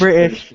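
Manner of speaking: "British" with the middle T left out. Shown as a demonstration. Correcting a friend's accent, "British" said with no T. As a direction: knock the T out of "British". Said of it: "There is no T".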